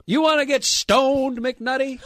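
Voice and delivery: high, loud, slow taunt; drill sergeant. Retold or imitated. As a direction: draw it out like a sergeant. First sergeant taunting recruit.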